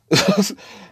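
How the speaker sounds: a loud, high laugh breaking out; high burst of laughter. Real, not acted. Laughing at his cellmate watching The View. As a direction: crack up at the thought.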